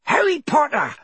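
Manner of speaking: squeaky, excited elf; high-pitched creature. A narrator's reading. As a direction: pipe up very high, full of awe. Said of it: "in a high-pitched voice".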